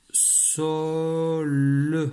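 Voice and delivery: "sol" very slowly, every sound held; sounded-out syllable. Shown as a demonstration. Teacher writing sol for the pupil, "sol" drawn out slowly. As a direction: stretch "sol" out, lingering on vowel and l.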